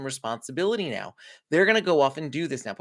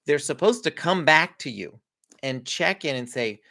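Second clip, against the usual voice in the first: ordinary talk, nothing marked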